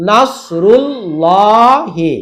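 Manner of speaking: নসরুল্লাহি very slow with a thick dark L; slow recited নসরুল্লাহি; heavy L in Nasrullahi. Shown as a demonstration. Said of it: "নস মোটা".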